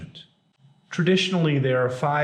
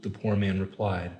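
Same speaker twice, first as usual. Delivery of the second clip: ordinary talk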